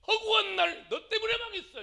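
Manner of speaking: high, loud mock accusation; angry blaming. Preacher imitating a ruined person blaming others daily, angry. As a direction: act out someone hurling blame.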